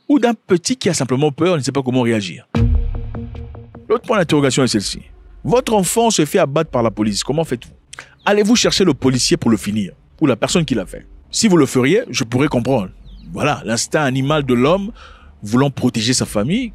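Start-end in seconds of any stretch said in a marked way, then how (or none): none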